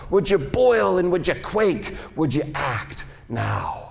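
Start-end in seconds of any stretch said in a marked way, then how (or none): 2.2-3.9 quiet plea. Acted. Quiet pleading repeat.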